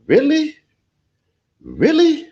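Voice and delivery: very high disbelieving "Really?"; can't believe what he saw; incredulous. Real, not acted. Disbelief at the march video.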